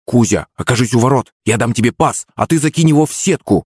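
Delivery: ordinary delivery, nothing marked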